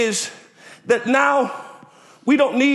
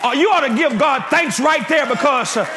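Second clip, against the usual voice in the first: preaching at full force; 4 semitones higher than usual. Acted. Pastor rousing the congregation to thank God, preaching at full force. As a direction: whoop it up to the church, pushing the pitch high.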